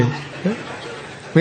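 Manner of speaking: words stretched out slow through a laugh; laughter breaking the words. Real, not acted. Laughing as he tells the congregation a wedding story.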